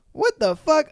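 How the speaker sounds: high, loud what the fuck, rising; shocked outburst. Real, not acted. Reading a reddit story, shocked at the mother-in-law. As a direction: blurt it out in disbelief.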